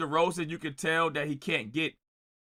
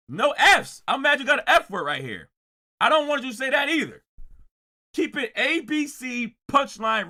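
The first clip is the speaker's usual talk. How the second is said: mock scolding; 6 semitones higher, 6 dB louder than usual. Scolding Drake mockingly.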